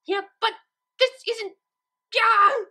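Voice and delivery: high, loud, breaking off into a cry; flustered outburst. A narrator's reading. Twilight losing it over Pinkie's impossible math. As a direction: sputter then explode in frustration.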